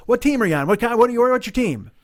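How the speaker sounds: high, loud, eager pestering; enthusiastic questioning. Retold or imitated. Stranger quizzes racers about their team, enthusiastic. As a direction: fire questions like an over-keen fan. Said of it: "He's all enthusiastic and inspired".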